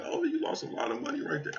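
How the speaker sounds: teasing imitation of a skeptic; mocking a viewer. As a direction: squeak it out like a know-it-all viewer. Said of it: "you be like".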